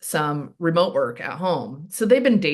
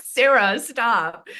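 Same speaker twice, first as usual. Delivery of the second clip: higher, louder mock plea to stop; exasperated friend; 7 semitones higher, 4 dB louder, much slower than usual. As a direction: play the friend begging her to quit teasing. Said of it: "And he was like".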